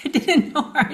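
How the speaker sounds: laughing protest. Real, not acted. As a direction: laugh while insisting it's true. Insisting through laughter.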